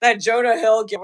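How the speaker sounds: ordinary talk, nothing marked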